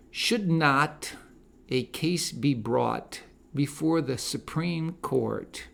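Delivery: ordinary talk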